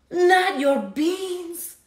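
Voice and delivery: high, slow outraged wail; outraged cry. Acted. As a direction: protest in horror. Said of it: "cried Skipito, outraged".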